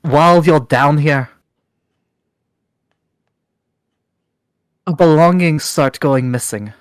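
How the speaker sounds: stern, accusing dwarf matriarch; suspicious dwarf leader. Acted. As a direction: press them hard with suspicion. NPC dwarf leader interrogates.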